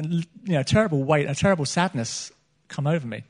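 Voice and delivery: usual speaking voice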